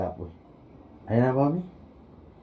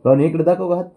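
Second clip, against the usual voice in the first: calling out; 8 semitones higher, 9 dB louder, faster than usual. Real, not acted. Calling to Rohan across room.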